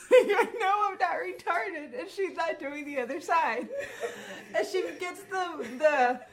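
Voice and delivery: laughing. Real, not acted. Laughing while retelling.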